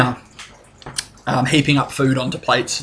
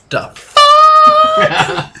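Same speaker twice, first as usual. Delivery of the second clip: stunned; 6 dB louder than usual. Acted. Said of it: "got the look of".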